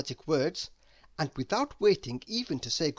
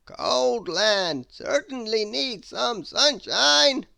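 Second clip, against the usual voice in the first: high, loud, slow theatrical wizard; 7 semitones higher, 7 dB louder, slower than usual. Acted. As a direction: savour every grand word like an eccentric wizard. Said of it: "said the wizard".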